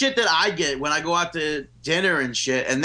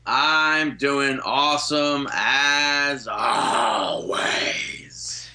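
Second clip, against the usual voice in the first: drawn-out voice; 5 semitones lower, much slower than usual. Acted. Drawn-out greeting dropping to whisper.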